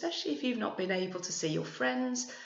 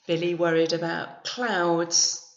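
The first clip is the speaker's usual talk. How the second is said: story narration; 7 dB louder than usual. A narrator's reading. Reads picture book aloud.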